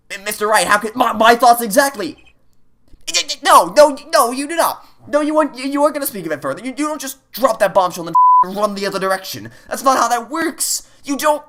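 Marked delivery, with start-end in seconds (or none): 3.1-11.4 high, pressing no no no; outraged protest. Real, not acted. Player to the game character, high and outraged. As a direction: scold the game in disbelief.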